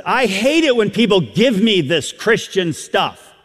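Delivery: imitating a loud public objection. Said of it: "They said very loudly".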